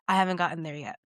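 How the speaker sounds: ordinary talk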